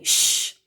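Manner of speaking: hard unvoiced shh; nanny's loud shush. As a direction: shush him firmly. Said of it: "shushed Nanny".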